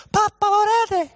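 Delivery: high, loud little-daughter call; voiced daughter. Daughter calls to her dad on the roof, imitated high child voice.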